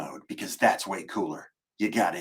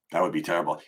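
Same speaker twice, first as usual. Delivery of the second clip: ordinary talk, nothing marked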